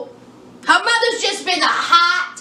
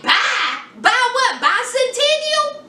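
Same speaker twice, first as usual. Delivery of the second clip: rising puzzled questions, much higher at the end; mock confusion; 6 semitones higher than usual. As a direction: play dumb, climbing up. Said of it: "I guess she was like".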